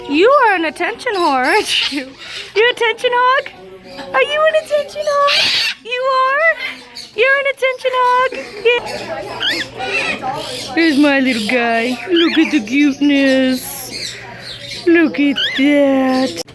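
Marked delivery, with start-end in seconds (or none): 2.5-10.7 high squeaky teasing voice. Real, not acted. Teasing pet bird. 10.7-16.4 slow low cooing. Real, not acted. Cooing at birds.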